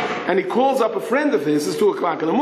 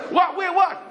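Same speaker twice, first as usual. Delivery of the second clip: high startled questions; confused alarm; 13 semitones higher than usual. Acted. Family woken in panic.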